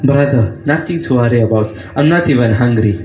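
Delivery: put-on a kindly old saint gladly accepting; pious elder. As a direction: accept warmly, as a humble holy man. Buzruk reassures.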